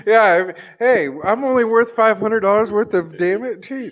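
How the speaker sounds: high mock-wounded pride; mock offended. Friend riffing on the cheap hit, high mock-wounded voice.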